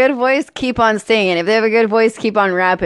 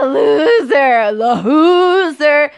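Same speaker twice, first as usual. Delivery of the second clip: drawn-out, jeering, higher-pitched taunt; mock them with stretched-out syllables; 4 semitones higher, 3 dB louder, much slower than usual. Real, not acted. Ribbing a friend who's behind on a show, taunting.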